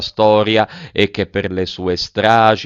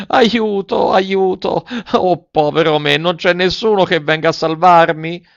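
high, wailing plea from a storybook puppet; acted tearful call for rescue; tearful cry for help; 8 semitones higher, 4 dB louder than usual. A narrator's reading. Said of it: "piangendo diceva".

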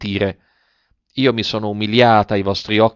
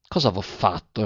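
much softer, a hushed plea; pleading quietly; 2 semitones lower, 7 dB quieter than usual. Acted. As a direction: ask it softly, hands clasped. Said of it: "giungendo le mani".

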